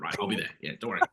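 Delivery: low, hushed offhand agreement; murmured mock-casual yes; retold quiet assurance. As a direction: brush it off softly, voice dropped low. Retold bluffing reply to the producers.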